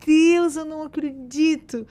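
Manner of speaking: tearful disbelief. Retold or imitated. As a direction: relive the moment of tearful joy. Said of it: "Na hora eu comecei a chorar". (espanto emocionado, quase chorando)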